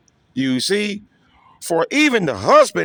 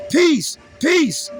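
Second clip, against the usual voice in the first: mock peace-sayers; 7 semitones higher than usual.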